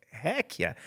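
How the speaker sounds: high-pitched cheer at normal volume; excited little high-pitched whoop; retold excitement. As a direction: squeal it lightly, like cheering on a kid. Dad retells cheering on excited daughter.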